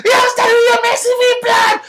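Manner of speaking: high falsetto threat; hammed-up shrieking road-man; shrieking roadman. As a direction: squeal the threat way up high and loud. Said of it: "goes walking up".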